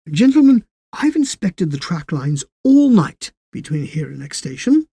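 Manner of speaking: addressing the men officially; station master voice. Acted. Paxton speaks.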